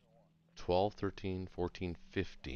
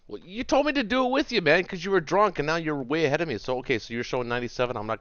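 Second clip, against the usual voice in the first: exasperated outburst; 10 semitones higher, 10 dB louder, faster than usual. Real, not acted.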